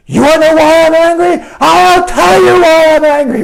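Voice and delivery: loud, high-pitched acted outburst; angry retort. Barb answering why she is angry, acted angry outburst. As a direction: blow up like someone asked the wrong question. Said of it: "the more angry they get".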